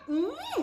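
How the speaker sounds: very high, soft, lingering "mmm"; demonstrated savouring hum. Shown as a demonstration. Coach shows followers the reaction, savouring hum. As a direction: squeal it softly through closed lips. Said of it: "ha, dia macam".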